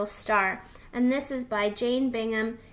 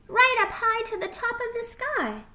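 high, soaring little fox voice; sing-song it up high with glee; excited child reaching skyward; singsong delight; 13 semitones higher, 4 dB louder than usual. A narrator's reading. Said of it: "he sang".

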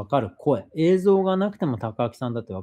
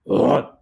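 louder surprised うわっ yelp; mock yelp; 7 dB louder than usual. Showing how Takaaki voices a startled yelp, louder. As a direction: blurt out a startled cry. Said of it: "っていう声みたいなのの出し方".

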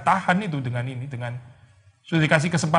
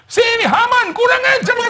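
very high, louder hammed-up shout at Haman; mock yell; 5 dB louder, slower than usual. Esther imagined screaming at Haman, hammed-up shout. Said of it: "bisa jadi terbakar".

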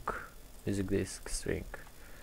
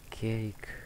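whispered; 8 dB quieter than usual. Real, not acted. Item name whispered.

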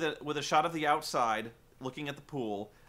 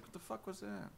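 wary dog; 3 semitones higher, 16 dB quieter than usual. Retold or imitated. Dog voiced, noise downstairs.

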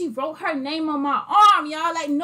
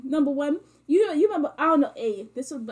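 ordinary talk, nothing marked

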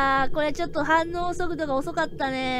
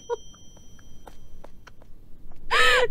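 shrieking laugh. Real, not acted. Character carried off.

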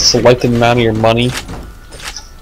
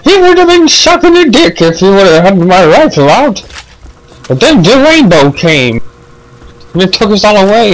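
silly high voice; 9 semitones higher, 4 dB louder than usual. Acted. Repeats line in silly voice.

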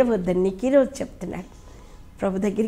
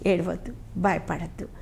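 tender comfort; 4 semitones lower, 9 dB quieter than usual. Acted. Preacher comforting grieving listeners.

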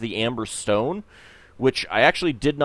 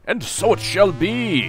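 grand proclamation; 8 semitones higher, 3 dB louder, slower than usual. Pretend. Grandly casts the magic map.

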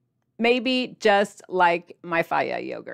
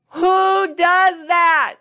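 higher, louder, very slowly drawn-out mock shout; 1 mock yell; 10 semitones higher, 9 dB louder, much slower than usual. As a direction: stretch it out as if shocked. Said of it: "And then I'm like".

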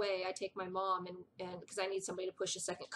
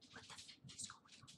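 hushed, stunned whisper; whispered what the f; whispered disbelief; 21 dB quieter than usual. Retold or imitated. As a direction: whisper it in shock. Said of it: "and I'm just like".

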